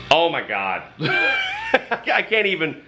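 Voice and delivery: high laugh dragged into the words; too amused to finish the thought; real helpless laughter. Real, not acted. Overcome imagining the consequences.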